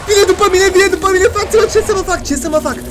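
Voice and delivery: high, loud frantic yelling; panicked screaming. Real, not acted. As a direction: scream for help while being chased. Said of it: "cât o să urlu acum".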